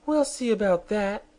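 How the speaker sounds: high, sharp angry comeback; queen retorting angrily. Acted. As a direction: snap back at the defiant girl. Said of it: "retorted the Queen angrily".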